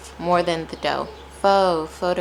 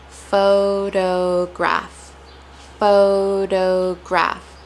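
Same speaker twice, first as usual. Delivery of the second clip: "Photograph" very slowly, syllable by syllable; each syllable of "photograph" held and separated; much slower than usual. Shown as a demonstration. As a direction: stretch out PHO-DO-GRAPH. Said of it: "when I separate it".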